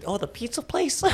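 high, prissy mimic; mocking naive tone. Friend mocks the pizza place mix-up, prissy voice.

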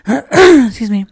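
normal talking voice

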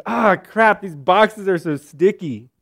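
high, loud griping about sticky boxes; mock whining. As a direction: imitate a grumbling co-worker, whiny. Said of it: "this guy's whining".

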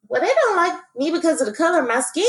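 lightly voiced little girl thinking aloud; child's inner thought. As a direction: voice the young girl's hurt thought quickly. Voicing her child self's thought.

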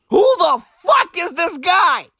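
mock-outraged cry; incredulous shout. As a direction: demand an answer, yelling. Exasperated rant question.

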